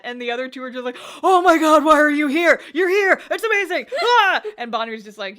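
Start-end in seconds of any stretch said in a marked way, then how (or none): 1.2-4.4 loud, high mock-tearful squealing; hammed-up wailing with joy; overjoyed outburst. Two friends greeting long-lost Bonri, hammed-up overjoyed outburst.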